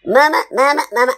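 high, loud gleeful cub; very happy baby bear. Acted. Happy baby bear calls to its mother, excited. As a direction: call out for mother like a delighted child. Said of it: "this baby is being very happy".

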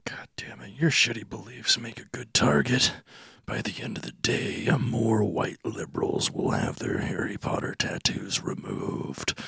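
soft, slowing down, utterly worn out; exasperated. Acted. Agent threatens Rowling's platform, quietly exasperated. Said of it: "Just exasperated".

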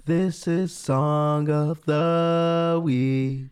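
slow drawn-out show announcement; announcer voice. Acted. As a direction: announce the segment slowly. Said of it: "segment intro announcement".

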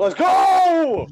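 high, loud hype yell; excited shout. Real, not acted. Co-host cheering as Fox comes back, excited shout. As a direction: shout it out with the last word held.

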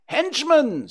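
very high, loud screech; piercing high bird cry; screeching jays. A narrator's reading. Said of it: "screeched the jays".

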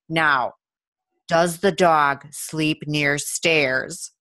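drawn-out deadpan question; a slow, solemn official voice; slow grave question. Retold or imitated. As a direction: ask it very slowly and dead serious.

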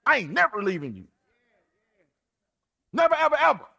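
high, loud, fast street bravado; boastful Peter. Retold or imitated. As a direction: swear loyalty like a hype man. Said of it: "Peter was like".

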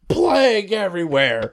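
drawn-out comic cry of disaster; mock horror. Teller acting out the body landing, mock horror.

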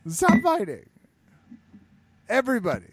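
high, loud plea; exasperated plea. Acted. Cohost begs aging fighters to stop, high and loud. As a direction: beg them to stop.

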